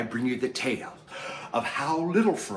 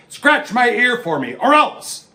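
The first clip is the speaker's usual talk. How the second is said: loud high bark at a subject; bossy lion; 9 semitones higher, 7 dB louder than usual. Acted. Lion ordering the animals, loud and bossy. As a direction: order them around. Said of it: "bossing everyone around".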